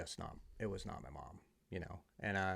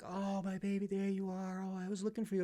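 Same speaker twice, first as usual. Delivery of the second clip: much higher, gushing mom voice; melting drunk mom; 11 semitones higher than usual. Retold or imitated. Drunk mom finding her son at the party, high gushing coo. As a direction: coo it like a mother melting down in relief.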